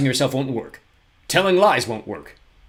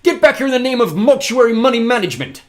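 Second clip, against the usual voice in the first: much higher and louder yell; shouting; 13 semitones higher, 11 dB louder than usual. A narrator's reading. Poe yells at fleeing Olaf, shouting. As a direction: bellow after the fleeing villain. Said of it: "Mister Poe shouted".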